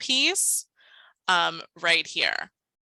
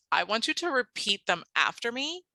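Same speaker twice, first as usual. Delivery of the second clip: usual speaking voice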